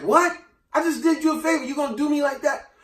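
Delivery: high, stunned what?; shocked at the betrayal, pitched well up; hurt, disbelieving farmer; outraged disbelief. Retold or imitated.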